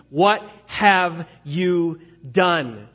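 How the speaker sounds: very slow, word-by-word exasperation; a little exasperated. Acted. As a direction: space out each word, demanding an answer. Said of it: "he's getting a little exasperated here".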